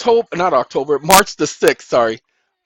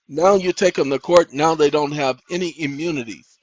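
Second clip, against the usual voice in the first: normal talking voice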